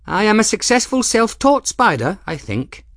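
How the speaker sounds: high, bright, loud calling voice; young woman's voice. Acted. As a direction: call it down cheerfully from the loft. Folly calls down to Ferris.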